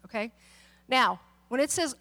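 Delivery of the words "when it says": normal talking voice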